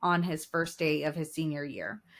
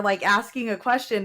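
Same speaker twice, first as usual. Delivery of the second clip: ordinary talk, nothing marked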